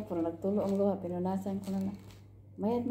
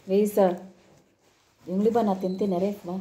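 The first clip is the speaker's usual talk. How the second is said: louder call across the house, falling at the end; 6 dB louder than usual. Real, not acted. Calling Risa from another room, louder. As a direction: call her name again, louder.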